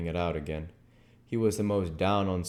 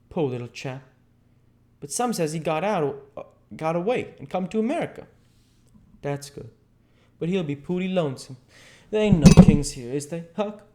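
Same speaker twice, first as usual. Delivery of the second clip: lightly voiced Jim, higher than narration; Jim, sympathetic; 8 semitones higher, 6 dB louder than usual. Jim asks Huck about the lonesome dauphin, sympathetic. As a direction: wonder aloud in gentle dialect.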